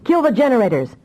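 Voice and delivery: urgent command. A narrator's reading. Officer ordering the generators cut, urgently. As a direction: shout the order to cut power. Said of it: "Ackerman snapped".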